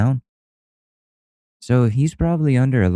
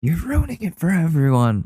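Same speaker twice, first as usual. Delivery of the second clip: Tom Cruise scolding; 8 semitones higher than usual. Retold or imitated. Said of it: "Tom Cruise yelling at these Karens".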